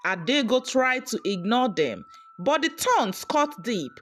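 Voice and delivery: usual speaking voice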